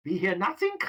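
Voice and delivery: high, hammy bad German accent; Sergeant Schultz German accent. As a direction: play the dopey German guard with a bad German accent. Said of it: "I'm doing a bad German accent".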